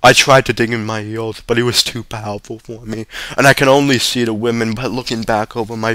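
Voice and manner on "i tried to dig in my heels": plain reading voice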